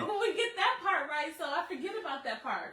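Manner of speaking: loud, high, laughing and excited; bubbling over with excitement. Real, not acted. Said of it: "I get so excited".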